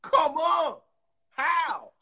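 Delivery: high, loud disbelief; baffled outburst; incredulous. Real, not acted. Reaction to the quoted accent.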